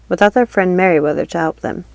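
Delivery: ordinary delivery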